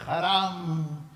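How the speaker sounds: mock angry outburst in Arabic; angry Arabic cursing. Samir cursing at the fine, mock angry Arabic yell. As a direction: curse it out in Arabic, retelling the rage. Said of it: "Samir se vuelve loco y empieza a gritar en árabe".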